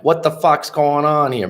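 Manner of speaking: put-on New York accent drifting toward Boston. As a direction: lightly imitate a brash New York accent. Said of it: "that New York accent".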